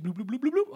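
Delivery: rising mock power-up sound; power-up bloops. Mimicking Mario eating a mushroom, rising bloops.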